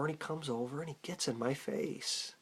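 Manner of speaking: ordinary delivery, nothing marked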